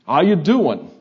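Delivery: punchy, high New Jersey greeting; New Jersey accent. Retold or imitated. New Jerseyan greeting a visiting Texas student, New Jersey accent. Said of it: "We s-, say it with a different accent".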